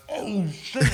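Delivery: high-pitched mock astonishment; shocked reaction. Passenger watches her punch him, high mock shock. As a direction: replay the shock, voice shooting up.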